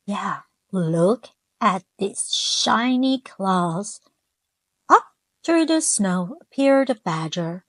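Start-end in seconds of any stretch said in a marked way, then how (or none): none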